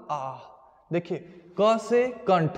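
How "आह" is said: pained groan. Retold or imitated. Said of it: "क्या बोला?".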